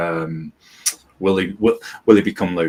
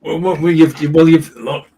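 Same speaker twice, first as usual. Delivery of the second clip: much higher and louder, tripping over words; eager interruption; 10 semitones higher, 8 dB louder than usual. Real, not acted. Co-host jumps in excitedly to defend the comedy. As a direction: burst in to defend the joke.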